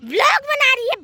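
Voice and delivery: high, very loud outburst; angry shout. Acted. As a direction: yell it in outrage. Mother-in-law shouts about the vlog. (ग़ुस्से में ज़ोर से चीख़कर)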